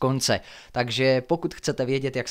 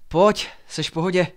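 raised, louder check on a friend; higher-pitched concern; concerned urging; 6 semitones higher, 5 dB louder than usual. A narrator's reading. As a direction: help someone up with a quick question. Friend helps the fallen boy.

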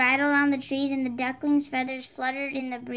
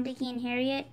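usual speaking voice

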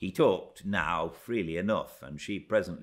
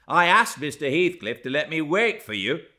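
raised, louder protest; heated, higher-pitched objection; kindling indignation; 7 semitones higher, 7 dB louder than usual. A narrator's reading. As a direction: fire back in self-defence. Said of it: "kindling up to her pertness".

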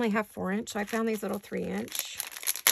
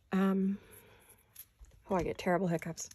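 ordinary delivery

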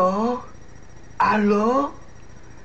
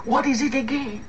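ordinary delivery

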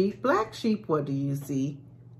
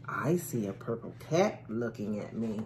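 plain reading voice

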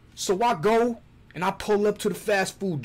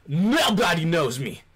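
normal talking voice

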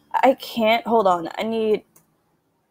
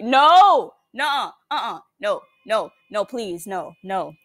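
frightened, forceful refusal; panicked refusal. Real, not acted. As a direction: shout it in alarm. Refusing to get closer in game.

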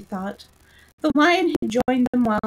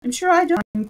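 growled; 7 semitones higher, 3 dB louder than usual. A narrator's reading. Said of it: "growled out".

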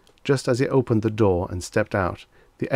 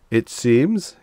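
hesitant, sheepish admission; small chastened voice; 4 semitones higher, 5 dB louder than usual. A narrator's reading. Mister Demise having read the book, chastened. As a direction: admit it as one chastened, voice lifted. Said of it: "in a small, chastened voice".

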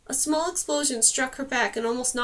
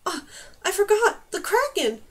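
higher, louder startled gasp; gasped realization; 7 semitones higher, 4 dB louder than usual. A narrator's reading. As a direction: blurt it out in sudden alarm. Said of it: "She gasped".

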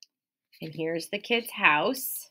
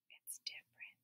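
breathy secret whisper; mysterious whisper; 25 dB quieter than usual. Acted. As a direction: let them in on a secret. Mysterious reveal to kids.

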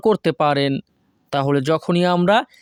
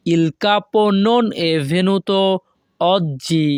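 slow, careful Italian model reading; teacher pacing it out; slow model sentence; much slower than usual. Shown as a demonstration. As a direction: say it slowly for learners to copy. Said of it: "তখন আমাদেরকে বলতে হবে".

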